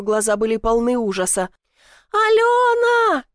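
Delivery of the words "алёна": высокий надрывный вопль, протяжно; голос срывается на имени; отчаянный крик. Acted. Подруга кричит в ответ. (сорвавшийся крик)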